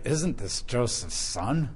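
doubtful reading. Shown as a demonstration. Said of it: "Or you can read it like".

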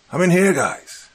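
high, loud call for help; yelling. A narrator's reading. Trapped man calls to his friends, high loud yell. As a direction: call out so they hear through the door. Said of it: "I yelled".

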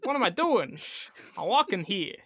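hammed-up swooping New York actor voice; Christopher Walken. Playing along when told of Al Pacino, bad impression. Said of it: "really bad Christopher Walken impression".

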